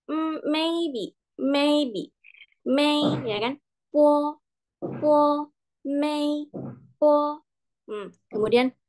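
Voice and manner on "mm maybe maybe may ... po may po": "may" and "po" high and level; match "po" to the high held "may"; first-tone demo. Shown as a demonstration. Teacher likening po to may, high level tone. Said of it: "Kita ambil bagian may-nya aja".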